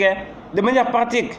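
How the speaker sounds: ordinary talk, nothing marked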